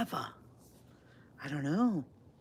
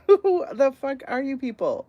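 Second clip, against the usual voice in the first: loud disbelief; 8 semitones higher, 7 dB louder than usual. Real, not acted. Baffled by strange channels.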